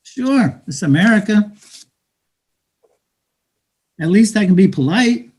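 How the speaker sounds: street hustler taunting a newcomer; bitter, mocking street talk to a dreamer; cynical hustler. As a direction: talk down to him like a weary hustler.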